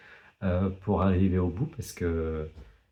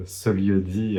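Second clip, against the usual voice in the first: ordinary talk, nothing marked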